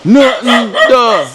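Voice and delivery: hammed-up imitation of a nagging woman; mocking her. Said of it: "She's like".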